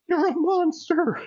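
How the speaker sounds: high, lightly voiced whisper of the girl; a hint of the terrified girl; mock whisper. Retelling the trailer, the girl's lightly voiced whisper. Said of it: "she whispers".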